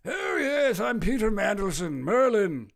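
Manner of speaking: put-on high, theatrical old wizard voice; Merlin voice. Streamer jokingly voicing the politician as Merlin. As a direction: play Merlin from Sword in the Stone. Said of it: "I sound like Merlin from Sword in the Stone".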